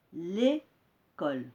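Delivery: L'école drawn out slowly, each sound clear; slow model word. Shown as a demonstration. Teacher slowly models L'école for the class. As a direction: stretch L'école for pupils. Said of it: "On va dire".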